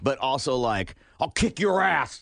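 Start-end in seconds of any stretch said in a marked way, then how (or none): 1.2-2.2 slow dim-witted brute voice; gruff mom impression, drawn out; Ogre voice. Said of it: "my impression of Gimpy's mom sounds like Ogre".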